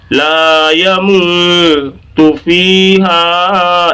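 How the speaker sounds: slow chant of لا یموت فیہا with held vowels; stretched recitation. Shown as a demonstration. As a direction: stretch laa, moo, fee and haa in لا یموت فیہا. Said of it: "اس طرح سے جوڑ لیں".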